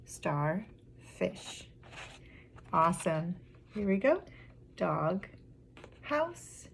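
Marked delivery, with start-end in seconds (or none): 0.1-1.7 low, soft "Star", pause, softer "fish"; syllable by syllable. Shown as a demonstration. Teacher counting syllables with children, softly split. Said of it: "Let's count how many we have". 4.8-6.7 syllable by syllable. Shown as a demonstration. Teacher letting children hear two syllables, broken apart. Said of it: "I heard dog".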